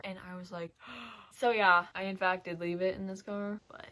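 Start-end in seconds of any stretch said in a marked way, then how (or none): 0.8-1.3 soft, sudden intake of breath; mock-stunned little gasp; shocked gasp. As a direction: gasp as if struck by realization. Acts out reaction to friend's text.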